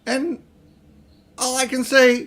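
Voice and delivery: high, loud angry outburst; a boy flaring up at his uncle. A narrator's reading. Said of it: "His cheeks were flaming with anger now".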